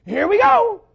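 put-on high, loud, thrilled kid shout; fired-up little kid. Little boy on a plane before takeoff, fired up. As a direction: yell it like a kid bursting with excitement. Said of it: "And the kid goes".

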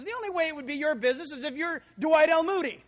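loud, quick, scornful comeback; a stranger scoffing at the preacher, loud and quick; loud retort. Acted. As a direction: throw it back at him, fast. Man retorts to Moody.

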